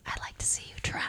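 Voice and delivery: whispered dare; hushed, unblinking taunt right in the face; close-up threat. Acted. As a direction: lean in and whisper a challenge. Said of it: "comes up really close to you".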